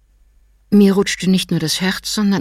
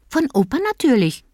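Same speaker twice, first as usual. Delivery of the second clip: put-on high, quick child's voice; disappointed little boy; 8 semitones higher than usual. A narrator's reading. Little boy telling parents the intruder is only Tommy, high child voice. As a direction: sound like a sleepy disappointed kid. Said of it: "sagte Nico".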